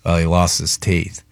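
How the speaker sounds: normal talking voice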